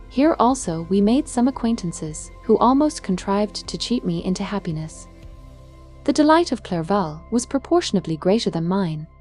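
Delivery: plain reading voice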